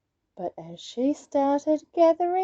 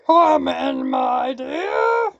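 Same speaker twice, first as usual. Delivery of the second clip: wolf faking Granny, louder and scratchy; deep scratchy voice; 5 dB louder, much slower than usual. Acted. Wolf in disguise greets Little Red, deep scratchy voice. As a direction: rasp sweetly to lure her in. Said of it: "a deep, scratchy voice".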